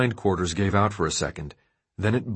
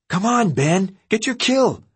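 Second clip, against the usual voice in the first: high shrill-free loud yell; 11 semitones higher, 4 dB louder than usual. A narrator's reading. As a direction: yell at him to get going. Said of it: "DeAndre yelled".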